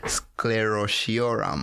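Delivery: slow Sclerotiorum, each syllable apart; staccato. Shown as a demonstration. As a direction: sound out Sclerotiorum slowly. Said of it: "give me syllable by syllable".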